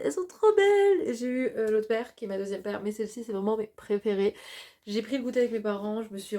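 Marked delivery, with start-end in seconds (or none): 0.0-1.1 high, bright burst of delight; thrilled gush. Real, not acted. Vlogger showing off her new glasses, excited high gush. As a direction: gush over them, much higher and louder.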